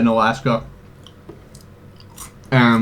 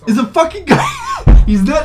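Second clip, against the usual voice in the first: incredulous outburst; 13 semitones higher, 6 dB louder than usual. Real, not acted. Laughing protest to a friend, high and loud. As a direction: protest in amused disbelief.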